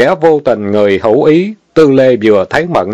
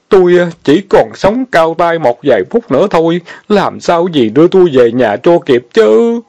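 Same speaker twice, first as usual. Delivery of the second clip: mock wailing; 7 semitones higher than usual. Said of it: "khóc trống lên thật là thê thảm".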